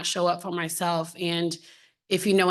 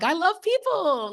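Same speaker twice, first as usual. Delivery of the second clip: high, drawn-out playful gush; hammed-up delight, pitched way up; gushing enthusiasm; 12 semitones higher than usual. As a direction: sing out your love, high and slow. Acts out her own people-loving enthusiasm.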